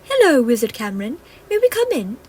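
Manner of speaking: high, light fairy greeting; a sprightly little fairy at the door; lightly voiced fairy.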